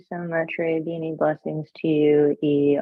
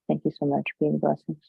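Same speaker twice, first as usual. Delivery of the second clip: ordinary talk, nothing marked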